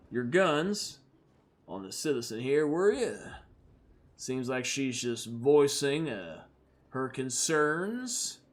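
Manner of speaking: measured, unhurried cowboy menace; calm threat from a lone gunslinger; cool gunslinger. Acted. Red R warning the Targ guards off the grieving woman, calmly.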